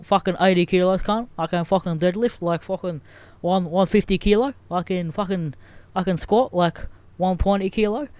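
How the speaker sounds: boastful gym bro. Pretend. Joking about going to gym, puffed-up bro boasting. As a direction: brag in short bursts like a gym meathead.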